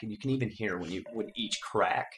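ordinary delivery

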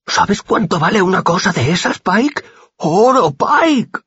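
high, loud eager gasping; greedy excitement. A narrator's reading. The boy telling Pike the lute is worth gold, high and thrilled. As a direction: thrill at the lute's value.

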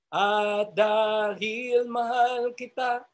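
tender, slow. Shown as a demonstration. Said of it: "demonstrates the loving phrase".

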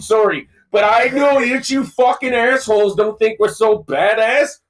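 cuss them out, then say sorry; angry Canadian fan. Retold or imitated. Angry Canadian fan yelling at the podcast hosts in a mock yell. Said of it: "badass Canadian fans that are like".

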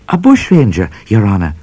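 lightly voiced polite young trooper reporting; a hint of the trooper. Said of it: "said Trooper O'Grady".

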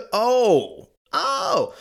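high, loud mock gasping "oh"; gasping parents. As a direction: act out the shocked parents. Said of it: "they're like".

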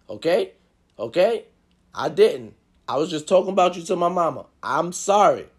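ordinary talk

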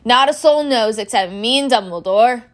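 loud, proud declaration. A narrator's reading. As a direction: boast with a touch of pride. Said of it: "said Hagrid proudly".

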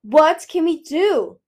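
loud, slow, high demand; drawn-out, forceful, desperate demand. A narrator's reading. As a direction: round on someone with the question. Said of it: "he whirled on Peril".

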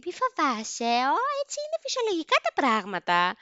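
lightly voiced friend, pitched high; put-on high voice waving the fear away; imitating amused friend. Friend reassuring the scared teen on the plane, imitated amused high voice. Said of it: "να εξεκαρδιστεί στα γέλια και να μου λέει".

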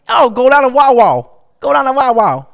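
loud, high Philly accent with exaggerated O's; mock a methed-out Philly accent; Philly accent, big stretched O's. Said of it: "it's, like, methed out O's".

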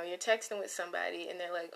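ordinary delivery

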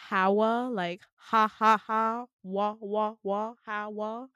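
"Hawa" split into loud repeated HA and WA; syllable-by-syllable pronunciation. Retold or imitated. Patient teaching the nurse her name, "Hawa" broken into HA and WA. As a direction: drill "Hawa" as ha, ha, wa, wa. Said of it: "it's pronounced Hawa".

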